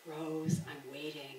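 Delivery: low, very soft mock complaint; mock impatient viewer. Host voices viewer waiting at home, mock impatient. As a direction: imitate a waiting viewer, dropping low and quiet.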